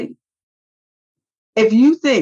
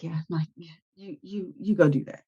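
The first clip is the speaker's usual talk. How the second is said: much softer, resigned mock reply; muttered quote; 2 semitones lower, 8 dB quieter than usual. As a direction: let it drop away under his breath. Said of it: "And he's like".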